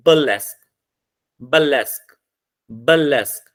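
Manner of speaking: "बलेस्क" repeated separately, weight on "Leask"; stress on last syllable. Shown as a demonstration. Teacher has students sound out the word, final stress on "बलेस्क". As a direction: model "बलेस्क" with a falling end on stressed Leask. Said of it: "last पे लगेगी Leask पे".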